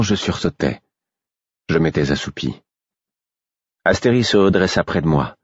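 usual speaking voice

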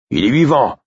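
high, loud gruff announcement; gruff, almost sullen. A narrator's reading. Guard announcing the prisoner is alive, gruff. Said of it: "annonça une voix bourrue, presque maussade".

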